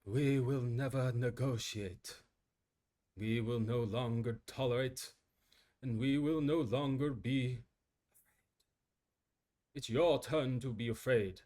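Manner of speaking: a slow, measured British president, lightly done. Acted. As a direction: deliver it like a British head of state. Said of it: "if I was the president and also British".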